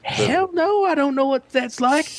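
high, mock shocked refusal; mock incredulous. Retelling to friends how he answered Sam, mock shocked. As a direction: sound scandalised, way up high. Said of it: "I was like".

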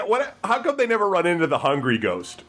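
high squeak of a what, then puzzled; mock bafflement. Laughing at the ghost word device, squeaking in fake confusion. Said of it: "I was like".

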